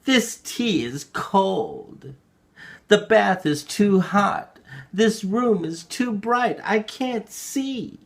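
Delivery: put-on high, slow, whiny old woman; complaining grandmother. A narrator's reading. Narrator mimicking the grandmother's complaints, slow high whine. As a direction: drag out each complaint like a fussy granny. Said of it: "My grandmother used to complain about everything".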